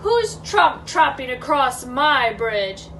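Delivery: slow, booming troll challenge; understated roar from below; troll's roar. Acted. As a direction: stretch it out as a menacing demand. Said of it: "A roar rose up from below".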